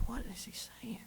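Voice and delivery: breathy hushed whisper to himself; whispered thought. Retold or imitated. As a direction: whisper the question under your breath. His own thought, he can't hear Ball.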